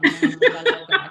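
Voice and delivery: laughing out loud as she speaks; bursting into laughter about God. Real, not acted. Laughing to the host about her love for God.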